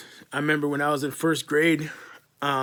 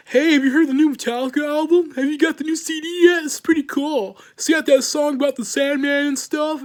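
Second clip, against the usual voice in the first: much higher and louder, eager mock fan voice; gushing fanboy; 12 semitones higher, 5 dB louder than usual. Former bullies now gush about the new album, high mock excitement.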